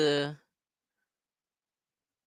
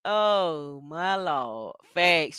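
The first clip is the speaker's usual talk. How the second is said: ordinary delivery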